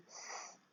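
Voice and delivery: S drawn out as a voiceless hiss; hissed S sound. Shown as a demonstration. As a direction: let the S hiss on, sss. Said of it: "chante donc".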